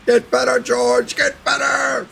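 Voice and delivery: high-pitched yelling at George. Retold or imitated. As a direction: squeal it out, way up high. Calling out to George.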